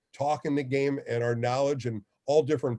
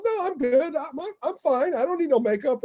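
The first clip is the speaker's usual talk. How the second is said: much higher, squeaky-toned retelling of his own refusal; mock protest; 14 semitones higher, 4 dB louder than usual. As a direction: play your younger self brushing it off, voice pitched way up. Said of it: "I go".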